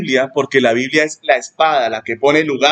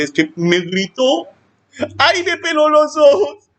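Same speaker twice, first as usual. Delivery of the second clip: slow, much higher whiny mock complaint; 12 semitones higher, slower than usual. Pastor mocking an offended member, slow and whiny high. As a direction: whine it like a touchy churchgoer, rising high.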